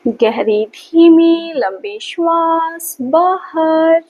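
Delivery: slow, sustained, stretched-out breath cues; measured yoga-teacher breathing count on a held tone; guided breathing. Acted. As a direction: draw each word out to pace a long breath.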